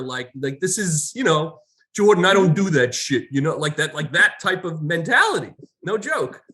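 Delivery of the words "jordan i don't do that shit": 1 tough New Yorker. Retold or imitated. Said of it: "badass New Yorker".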